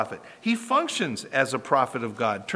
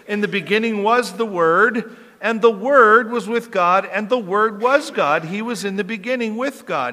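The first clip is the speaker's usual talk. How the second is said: louder, measured Bible reading; solemn scripture reading; 5 semitones higher, 5 dB louder than usual. Acted. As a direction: read it like sacred words. Reads John's prologue solemnly.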